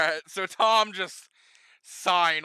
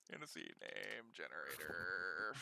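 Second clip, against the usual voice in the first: very low, hushed murmur; muttering to self; 11 semitones lower, 17 dB quieter than usual. Real, not acted. Game master looks up a name generator, muttering. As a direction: mumble absently while looking something up.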